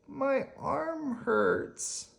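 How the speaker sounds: put-on high, slow child's complaint; hurt child in waiting room complaining; whimpering child.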